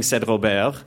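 usual speaking voice

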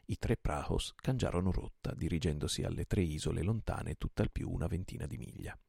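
ordinary delivery

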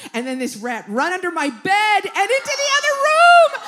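high, loud, rising shriek; comic terror, building to a scream; panicked yell. Retold or imitated. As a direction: tell it in hammed-up hysteria. Acting out panic at rat.

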